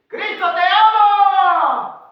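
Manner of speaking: high, very loud cry with a long-held last word; full shout. Acted. Preacher acting the pastor crying out, full shout. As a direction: shout it out, stretching AMA. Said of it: "Gritó el pastor".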